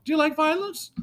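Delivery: high, cheery cartoon mouse gone twisted; Mickey Mouse. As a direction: ask it sweetly in a mouse falsetto. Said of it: "really good at Mickey Mouse voice".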